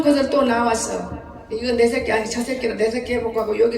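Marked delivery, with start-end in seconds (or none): none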